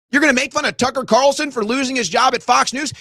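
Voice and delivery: ordinary talk, nothing marked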